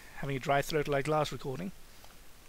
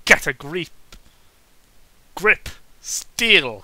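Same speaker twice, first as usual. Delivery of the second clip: mock pep talk; 8 dB louder, much slower than usual. Repeating the book line as self-mockery.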